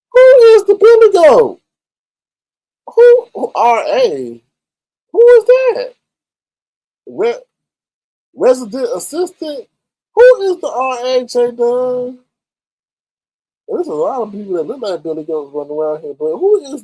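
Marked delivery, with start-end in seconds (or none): 0.1-11.3 high, loud, disbelieving who; baffled outcry. Real, not acted. Streamer baffled by chat's breaking news, shrill disbelief. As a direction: shriek out who in confusion.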